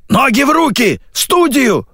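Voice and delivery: very high, insistent commands; urgent orders. A narrator's reading. Radio voice cuts in with orders, high urgent holler. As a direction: holler to get moving.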